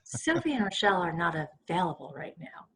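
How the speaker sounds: low hushed seductive answering machine message; sultry voicemail message. Retold or imitated. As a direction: purr the old voicemail like a come-on. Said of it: "we did something like this".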